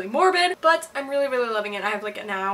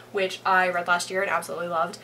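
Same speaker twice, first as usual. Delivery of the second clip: usual speaking voice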